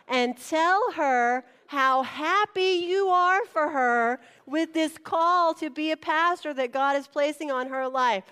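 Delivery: loud, high, drawn-out public call; imitated booming pulpit announcement; pastor's proclamation. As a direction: proclaim it to the whole church. Pastor calling congregation up, acted.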